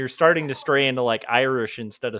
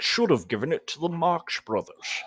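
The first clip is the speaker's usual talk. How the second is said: put-on light Scottish Sean Connery accent; a hint of Sean Connery; Sean Connery impression; 3 semitones higher than usual. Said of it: "the only Sean Connery I can really imitate".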